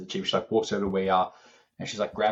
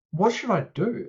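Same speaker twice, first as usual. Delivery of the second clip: high, mock-surprised mum voice; imitating the mum; 12 semitones higher than usual. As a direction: voice her like a dawning realisation, pitched up. Mum's voice in retold story.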